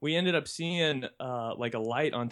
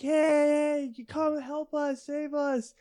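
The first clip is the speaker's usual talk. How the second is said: calling out; 14 semitones higher, 4 dB louder, slower than usual. Retold or imitated. Calling out to kayaker.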